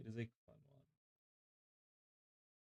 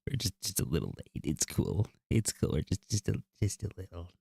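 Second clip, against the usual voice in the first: deep goofy voice; 12 semitones lower, 6 dB louder than usual. Pretend. Deep loud voice chant.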